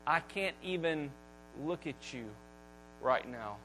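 very soft, dropping low, as if turning away; hushed voice of God. Acted. Preacher voicing God turning from his son, hushed. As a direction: let God's words fall to almost nothing.

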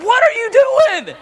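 high, slow, drawn-out mock outrage; exasperated dad. Dad catching son pouring cereal, mock exasperated. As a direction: stretch it out like a fed-up father. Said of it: "I said".

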